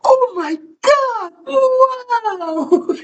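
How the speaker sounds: very high, loud falsetto squeal of fake awe; mock amazed squeal. Teasing a bragging coworker, high falsetto squeal. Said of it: "and I'd just be like".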